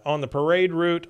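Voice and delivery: usual speaking voice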